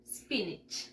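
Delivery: SPINACH as "SPIN-ich", with a weak I ending; English pronunciation. Shown as a demonstration. Teacher giving children the spoken form, SPINACH pronounced "SPIN-ich". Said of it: "y se pronuncia".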